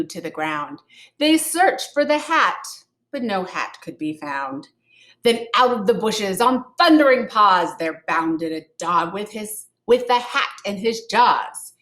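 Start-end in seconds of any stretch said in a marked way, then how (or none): none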